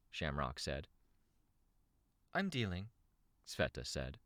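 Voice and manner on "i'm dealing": short, cool reply in a higher character voice; curt. A narrator's reading. Sveta asked about her body, curt. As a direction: answer curtly, without much warmth. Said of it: "still curt, without much warmth".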